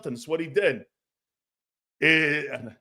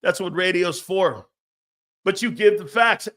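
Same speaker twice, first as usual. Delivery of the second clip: normal talking voice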